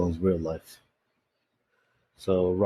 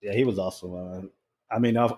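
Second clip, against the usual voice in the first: usual speaking voice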